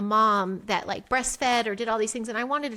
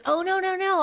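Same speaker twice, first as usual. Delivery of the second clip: high-pitched, mildly acted protest; retold insistence, pitched up; eager protest; 10 semitones higher, 4 dB louder than usual. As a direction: imitate a man rushing to object. Retells his eager protest.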